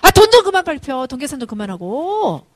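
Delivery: exasperated outburst, very loud and high; scolding yell. Retold or imitated. Mother fed up with son's money scheming, scolding yell. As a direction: explode at him to stop it. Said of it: "이제 소리를 지르죠".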